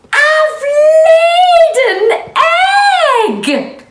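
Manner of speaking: high, slow, shouted shriek of joy. Acted. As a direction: shriek it out to the barnyard. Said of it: "shrieked Marjorie".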